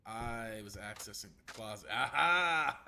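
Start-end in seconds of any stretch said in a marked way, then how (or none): 1.9-2.8 triumphant aha. Real, not acted. Finds the right spot in game.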